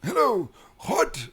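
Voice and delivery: put-on high, frail old man voice; quavering elderly temple master.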